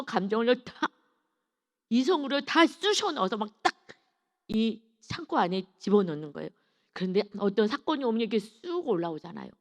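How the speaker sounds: ordinary talk, nothing marked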